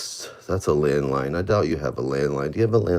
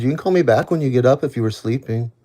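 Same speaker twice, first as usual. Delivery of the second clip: said again louder so he hears; louder for the one who missed it; louder repeat; 9 semitones higher, 9 dB louder than usual. Real, not acted. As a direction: raise the voice and repeat it clearly. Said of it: "I said".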